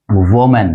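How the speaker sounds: वुमेन as WO-men; वुमेन with "wo", like the singular; common mispronunciation. Shown as a demonstration. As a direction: round the first vowel of वुमेन to "wo". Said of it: "आमतौर पर गलत बोलते हैं".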